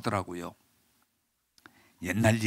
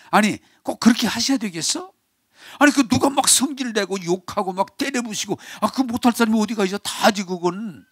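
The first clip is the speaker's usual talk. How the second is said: exasperated rebuke; 6 semitones higher than usual. Retold or imitated. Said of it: "꼭 그 얘기를 해줘요".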